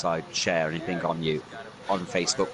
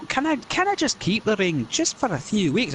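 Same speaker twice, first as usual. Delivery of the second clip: higher, wheedling Scots plea; pleading Scottish voice; 11 semitones higher than usual. Acted. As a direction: beg to keep the ring. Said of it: "he's gonna be like".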